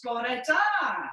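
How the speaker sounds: shouted Bore da; Welsh greeting shout. Shown as a demonstration. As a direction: call Bore da across the street. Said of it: "the Welsh people get everywhere".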